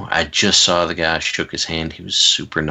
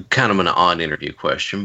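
ordinary talk, nothing marked

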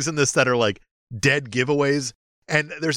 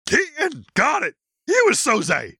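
high dopey creature voice; goofy high-pitched monster; Sasquatch voice; 12 semitones higher, slower than usual. Acted. Sasquatch excited.

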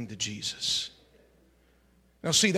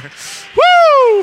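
high, loud ecstatic woo; a shouted woo of praise; joyful whoop; 17 dB louder than usual. Real, not acted. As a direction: let out a jubilant church whoop. Preacher's ecstatic cry.

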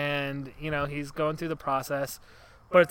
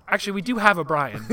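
loud, slightly raised soldier report; mock soldier; 4 semitones higher, 6 dB louder than usual. Soldier telling Pontius, lightly voiced report. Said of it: "one of the soldiers says".

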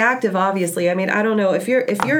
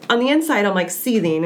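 normal talking voice

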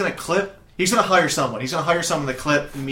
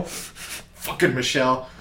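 ordinary delivery

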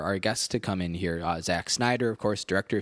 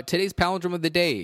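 high, loud announcer call of the segment; 9 semitones higher, 4 dB louder than usual. Acted. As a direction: announce it like a radio host. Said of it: "segment announcement".

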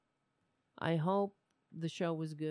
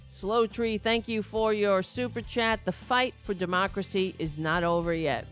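loud rousing sign-off over music; rally the crowd, building and proud; rally speech; 5 dB louder than usual. Acted.

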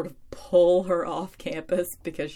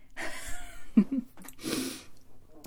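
breathy laugh; 8 semitones higher, 3 dB quieter than usual. Real, not acted. Laughs at Harry Potter remark.

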